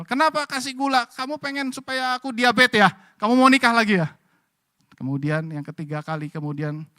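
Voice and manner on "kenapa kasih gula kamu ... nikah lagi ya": higher, loud accusing complaint; grumbling husband. Retold or imitated. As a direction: nag her about the sugar. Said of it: "Suaminya ngomel". (suami mengomel dengan nada tinggi)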